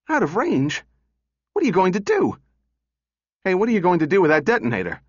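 high, incredulous questions; startled, high-pitched demand to know; alarmed questioning. Acted. Donovan to Powell holding a detonator, alarmed questioning.

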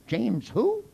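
high, mock-clueless question; a bright puzzled James who. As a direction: pitch it up like someone lost.

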